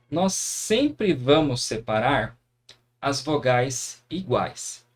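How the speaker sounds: slow, deliberate teacher statement; slow emphatic rule. Shown as a demonstration. Teacher to students, stating the rule slowly and deliberately. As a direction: lay out the rule word by word.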